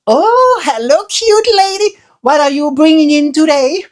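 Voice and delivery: high, flirty put-on clerk; mock clerk voice. Clerk greeting [name] at the counter, high hammed-up voice. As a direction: ham up a charmed postal clerk.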